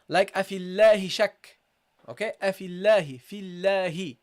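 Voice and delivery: اللَّهِ with a thin light l after kasra; light Allah. Shown as a demonstration. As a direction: recite أَفِي اللَّهِ with a light lam. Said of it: "you pronounce it lightly".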